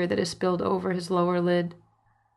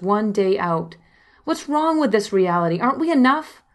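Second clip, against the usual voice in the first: higher, louder angry accusing; furious outburst; 4 semitones higher, 5 dB louder than usual. Retold or imitated. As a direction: let the rage pour out at him. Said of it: "Mama Bear rage sets in".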